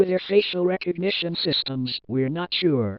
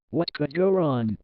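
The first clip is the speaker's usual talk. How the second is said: usual speaking voice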